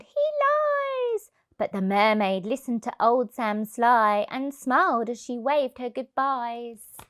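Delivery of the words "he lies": high, tiny warning voice; whisper-thin high warning from the shells; warning seashells. A narrator's reading. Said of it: "and the seashells warned".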